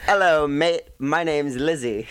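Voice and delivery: hammed-up, clumsy British introduction; bad British accent. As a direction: attempt a cheeky Brit and miss. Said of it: "The Queen would never say that".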